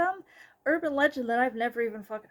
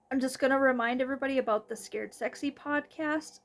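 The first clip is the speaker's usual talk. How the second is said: ordinary delivery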